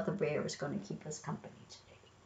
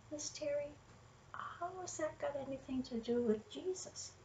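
soft, higher voice for a curious listener; listener's question; 5 semitones higher, 9 dB quieter than usual. Acted. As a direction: quietly put the young listener's question. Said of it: "You might say".

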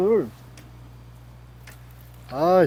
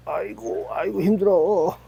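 tired groaning sigh; worn out from reeling; weary sigh; 6 semitones higher than usual. Real, not acted. Reeling in a big carp.

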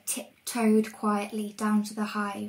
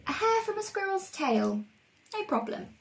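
witch voice; 10 semitones higher than usual. Acted. Velda reading her list.

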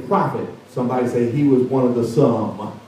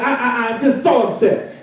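upset general; 9 semitones higher than usual. Acted. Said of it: "storming mad".

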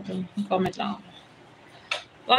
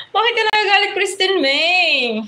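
mock hurt whine; 7 semitones higher, 3 dB louder than usual. Teasing a commenter who seems angry.

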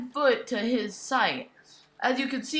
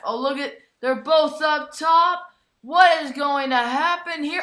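ordinary talk

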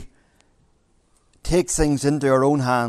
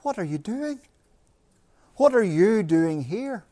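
mock incredulous question; 4 semitones higher, 3 dB quieter than usual. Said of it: "make them say".

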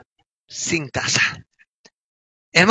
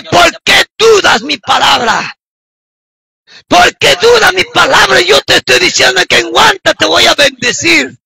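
raised, insistent divine rebuke; reproving voice of God; 5 semitones higher than usual. Retold or imitated. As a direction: act out the Lord correcting a doubter. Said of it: "el Señor le dice". (voz de Dios que reprende, con tono alto)

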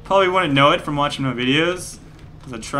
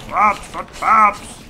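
mock game voice; 4 semitones higher, 4 dB louder, much slower than usual. Mimics game voice.

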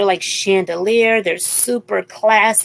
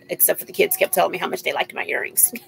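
whispered aside; 3 semitones lower, 10 dB quieter, faster than usual. Real, not acted. Quick aside about earrings.

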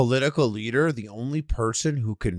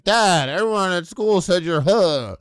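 put-on higher, louder kid voice, drawn out; kid relaying insult; 6 semitones higher, 4 dB louder, slower than usual. Kid telling a dad what school said, higher, drawn-out kid voice. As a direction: play a kid tattling to dad. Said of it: "they're probably like".

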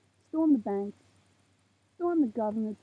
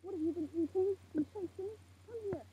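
high, hushed chiding; mild scolding; 5 semitones higher, 12 dB quieter than usual. Real, not acted. Trying to do a video here, softly chiding. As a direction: chide softly in a raised pitch.

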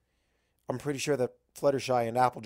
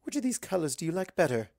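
high, coaxing question; Rarity's voice; 6 semitones higher than usual. Acted. Rarity asking Twilight to pick a color, high and coaxing. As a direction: offer the choice sweetly.

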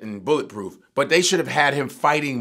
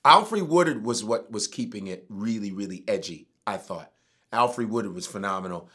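ordinary talk, nothing marked

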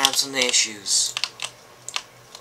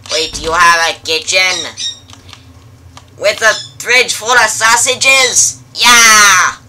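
loud, thrilled, higher and louder; excited; 6 semitones higher, 11 dB louder, slower than usual. Acted. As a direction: shout it with delight. Excited about the kitchen.

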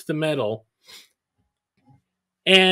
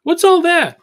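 mock partner voice, much higher and louder; partner's alarmed question; 12 semitones higher, 7 dB louder than usual. Partner asks Nash what the papers are, lightly voiced and higher. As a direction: pop up high and loud, lightly voiced. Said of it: "she's like".

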